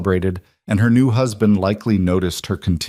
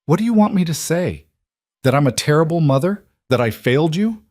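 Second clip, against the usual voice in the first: crumpling despair; 8 semitones higher than usual. Acted. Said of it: "Gabriella's face crumpled".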